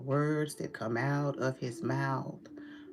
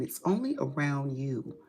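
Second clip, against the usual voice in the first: ordinary talk, nothing marked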